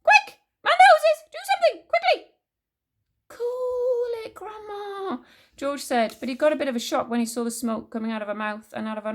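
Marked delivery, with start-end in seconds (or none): 0.0-2.3 high, loud frantic shrieks; yelling. A narrator's reading. Grandma demanding help from George, frantic high yelling. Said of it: "the old woman yelled". 3.3-5.2 put-on high, drawn-out boyish reply; calming George. A narrator's reading. George telling burning Grandma to settle, high and drawn out.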